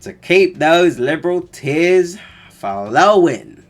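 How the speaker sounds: hammed-up American pitchman; mock American accent. Reading ad in American voice.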